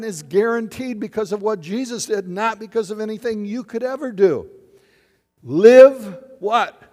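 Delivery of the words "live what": call for response. Real, not acted. Calls question to congregation.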